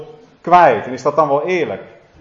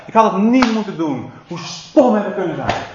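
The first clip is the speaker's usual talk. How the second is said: higher, earnest begging of a remorseful man; pleading for mercy; 7 semitones higher than usual. Acted. Drunk driver begging the judge for mercy, pleading higher. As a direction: beg the judge, full of regret. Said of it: "hij smeekt om genade".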